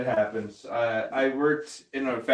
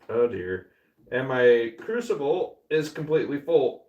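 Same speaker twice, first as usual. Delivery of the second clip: ordinary delivery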